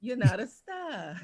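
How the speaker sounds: slow, loud Boston "stah"; Boston accent. Shown as a demonstration. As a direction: draw out STAR without R, Boston style. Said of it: "this is how you sound like you're from Boston".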